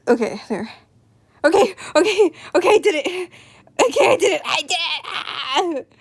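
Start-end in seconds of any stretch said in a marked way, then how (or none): 1.4-5.9 higher, louder little victory cheer; excited triumph. Real, not acted. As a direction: celebrate getting it done. Triumph after finishing promo.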